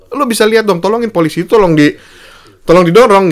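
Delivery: high, insistent begging to the police; urgent crowd plea. Retold or imitated. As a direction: beg the police to step in. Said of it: "Orangnya malah pada bilang di depan".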